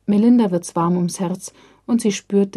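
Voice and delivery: ordinary delivery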